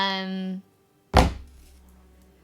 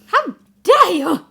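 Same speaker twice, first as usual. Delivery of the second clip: mock outrage; 9 semitones higher, 12 dB louder than usual.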